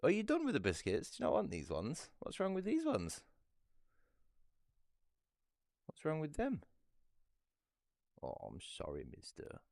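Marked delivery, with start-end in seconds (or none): 0.0-2.1 high coaxing question to the cat; pet talk. Real, not acted. Owner asking cat about biscuits, high pet voice. 2.2-6.6 high puzzled question to the cat; pet talk. Real, not acted. Owner puzzled cat won't eat biscuits, high pet voice. Talking to cat about treats.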